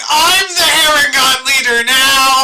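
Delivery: loud, slow, drawn-out declaration; triumphant proclamation. Acted. Character claims leadership of the bandits, triumphant proclamation. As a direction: proclaim it loudly, stretching the words.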